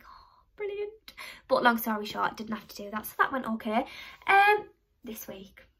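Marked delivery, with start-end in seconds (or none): 0.1-1.1 sarcastic whisper. Retold or imitated. Fed up with the student finance form, sarcastic whisper.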